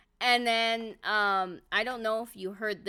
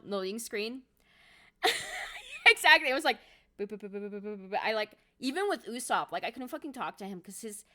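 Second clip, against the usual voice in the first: ordinary talk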